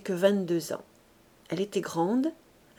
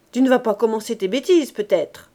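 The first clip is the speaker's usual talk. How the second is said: fast, louder annoyed rebuke; irritated scolding; 7 dB louder than usual. A narrator's reading. Man brushing off his anxious partner, fast and irritated. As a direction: brush her off impatiently.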